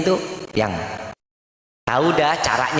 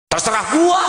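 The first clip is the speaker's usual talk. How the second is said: gruff husband retort; 4 semitones higher than usual. Retold or imitated. Grumpy husband answering his wife, mock angry shout.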